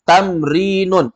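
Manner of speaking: teacher drills new word; slow model word. Shown as a demonstration. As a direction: stretch it for the class to copy. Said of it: "Semuanya ikuti".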